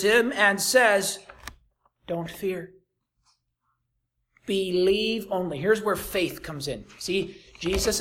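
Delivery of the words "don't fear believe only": soft, quiet words of comfort; calm the grieving father; gentle reassurance. Acted. Jesus quietly comforting the grieving father, softly reassuring.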